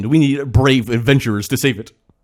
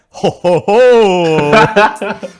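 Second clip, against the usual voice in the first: loud rising villain laugh; hammed-up villain ho ho ho; evil laugh; 6 semitones higher, 7 dB louder, much slower than usual. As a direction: cackle it big and triumphant.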